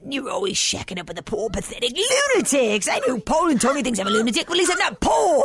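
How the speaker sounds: ordinary talk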